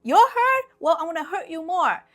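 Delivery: high, loud imitation of an angry parent; mock parental threat. Parents answering a hurt child, loud mock threat. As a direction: throw it back loudly, mocking the hurt.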